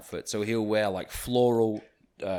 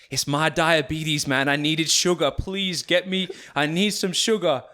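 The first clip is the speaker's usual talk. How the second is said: higher, slow, desperate mock plea; milk the fake sickness, begging; pleading; 6 semitones higher, 4 dB louder than usual. Drunk friend faking a diabetic attack for bouncers, in a slow, higher pleading voice. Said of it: "He's like".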